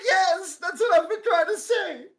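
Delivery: high, loud tearful wail; mock crying. Drunk friend finally gets his confession across, mock-crying wail. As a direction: imitate an exasperated drunk friend in tears. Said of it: "Why are you fucking crying?".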